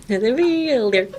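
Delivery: "Familiar" said through a laugh. Real, not acted. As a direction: laugh while saying "Familiar". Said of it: "laughing through the word".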